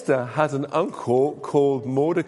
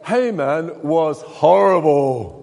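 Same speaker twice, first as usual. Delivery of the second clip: horrible drawn out slow and loud; stress on horrible; 5 dB louder, slower than usual. A narrator's reading. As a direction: lean hard on horrible. Storyteller stressing villain.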